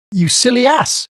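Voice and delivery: very high, loud angry fairy cry; furious shriek. A narrator's reading. Fairy Tinker Bell shrieks at Peter in a passion. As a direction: screech it in a tiny rage. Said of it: "cried Tinker Bell in a passion".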